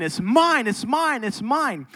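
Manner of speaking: mock child fighting over a toy; lightly voiced stubborn toddler; imitating a toddler. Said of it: "she just kept saying".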